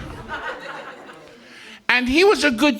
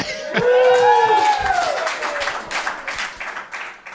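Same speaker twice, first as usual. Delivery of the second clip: high shriek laugh. Acted. Mock joy at Bastille Day.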